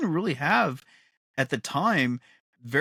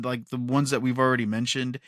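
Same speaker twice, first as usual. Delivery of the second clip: ordinary delivery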